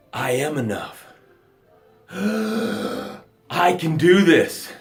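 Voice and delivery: soft, slow, breathless words; barely breathing after being pulled out; gasping revival. Pretend.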